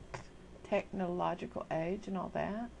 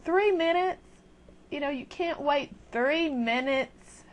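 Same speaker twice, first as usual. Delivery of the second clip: higher and louder, disbelief at three minutes; incredulous loud rant; 8 semitones higher, 8 dB louder, slower than usual. Real, not acted. Vlogger ranting at impatient chatters, loud disbelief. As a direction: scoff loudly at such impatience. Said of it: "ranting at impatient people".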